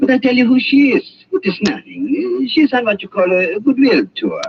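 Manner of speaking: high, hurried reassurance; nervous hurry. Acted. Doctor hurriedly waves off the gunboat to his suspicious guest, nervous and high. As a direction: talk fast to cover a lie.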